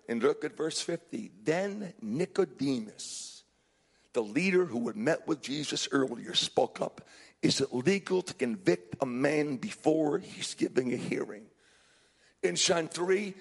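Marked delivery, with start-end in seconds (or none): 0.1-11.5 solemn scripture reading. Real, not acted. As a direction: read the verse gravely, lowering the voice. Measured, low scripture reading.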